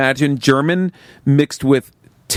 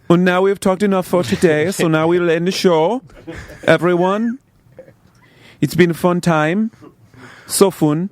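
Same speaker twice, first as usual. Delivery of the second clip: put-on higher-pitched German accent, measured phrases; German man; 4 semitones higher than usual. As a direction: sign off as a hammy German host. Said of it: "in the show as a German man".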